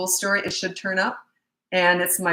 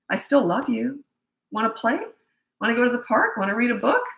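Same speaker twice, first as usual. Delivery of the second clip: higher, sweet questions with rising ends; loving invitation; 5 semitones higher than usual. Shown as a demonstration. Parent to child still under blackout, loving invitation. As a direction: coax the child kindly into playing.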